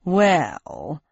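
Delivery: low grumbling mutter. Acted. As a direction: grumble it with a shrug. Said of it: "grumbled Ersatz Sprocket".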